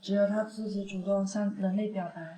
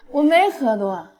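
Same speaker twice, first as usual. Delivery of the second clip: put-on drunk; 10 dB louder, slower than usual. Drunk girl insists she's sober.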